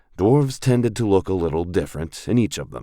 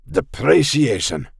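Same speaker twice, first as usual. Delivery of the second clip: slow, drawn-out word in a bad Scottish accent; failed Scottish accent; 7 semitones higher, 4 dB louder than usual. A narrator's reading. Dwarf player fumbling for a bombastic word in a fake Scottish accent. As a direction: land the wrong grand word with mock gravity. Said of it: "trying to think of an appropriately bombastic term".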